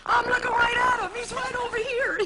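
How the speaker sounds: high, pointed mock-innocent reply; a cheeky jab at the rival; sly put-down. Comedian on the float mocking a feuding disc jockey, high and cheeky.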